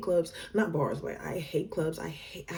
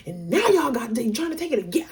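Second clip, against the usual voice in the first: higher, louder, mounting exasperation; overwhelmed rant; 6 semitones higher, 6 dB louder than usual. Real, not acted. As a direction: pile it up, getting more worked up. Worked-up recap of being overwhelmed.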